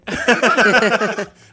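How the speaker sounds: big burst of laughter; loud laugh. Real, not acted. Laughs at the missing finger.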